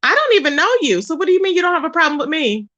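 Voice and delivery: normal talking voice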